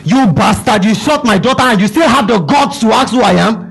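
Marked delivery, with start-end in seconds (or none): none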